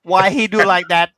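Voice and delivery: high, loud mock Thai accent; Thai accent impression. Imitating his Thai wife yelling at the footy, high loud mock Thai accent. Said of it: "she'll go in her Thai accent".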